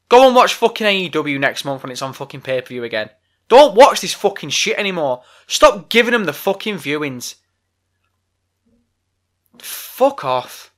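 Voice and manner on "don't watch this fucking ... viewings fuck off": angry rant. Real, not acted. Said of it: "vented a frustration".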